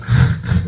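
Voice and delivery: short harsh "khh" rasps; guttural throat scrape. Shown as a demonstration. Showing where Dutch is spoken, guttural throat rasps. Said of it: "in the back of the throat".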